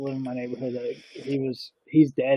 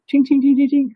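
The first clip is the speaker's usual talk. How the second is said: high, louder pinging of metal hitting pavement; metallic ting sounds; 12 semitones higher, 5 dB louder than usual. Retold or imitated. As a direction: chime it out like forks bouncing. Imitating silverware hitting ground.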